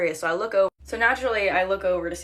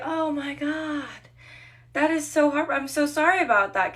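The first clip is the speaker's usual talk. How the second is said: high-pitched customer-service sympathy; gushing apology; 8 semitones higher, 3 dB louder than usual. Retold or imitated. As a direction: put on an over-concerned server voice. Acted over-apologetic server to customer.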